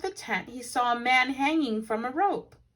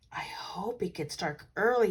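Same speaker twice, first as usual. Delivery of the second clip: whisper. A narrator's reading. Paddington hoping, excited.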